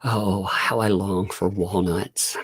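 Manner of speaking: hammed-up wistful lament; mock longing. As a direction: pine dramatically for walnuts.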